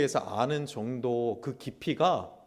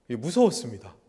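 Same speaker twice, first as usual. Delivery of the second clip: laughing; 4 semitones lower than usual. Real, not acted. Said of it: "기분이 어땠을까요?".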